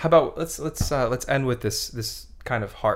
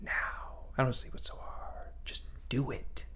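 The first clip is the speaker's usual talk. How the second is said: whispering; 2 semitones higher, 12 dB quieter than usual. Acted. Whispered aside to listeners.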